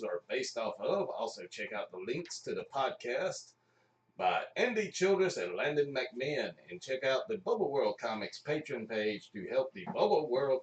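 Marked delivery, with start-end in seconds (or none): none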